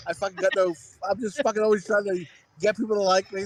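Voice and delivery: ordinary talk, nothing marked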